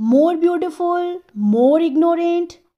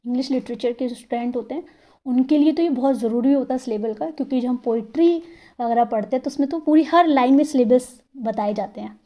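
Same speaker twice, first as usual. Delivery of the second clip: usual speaking voice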